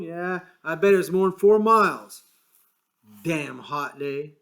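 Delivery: bitter grumble about the bus driver; angry. A narrator's reading. George cursing the bus driver, angry. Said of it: "He said angrily".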